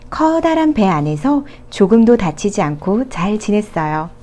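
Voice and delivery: usual speaking voice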